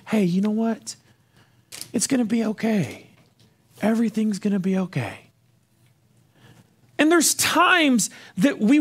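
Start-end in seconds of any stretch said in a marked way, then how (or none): 0.1-5.3 soft, gentle reassurance with pauses; softly reassuring, unhurried; comforting voice. Acted. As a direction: soothe them quietly, like Mister Rogers. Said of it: "we're gonna use our comforting voice".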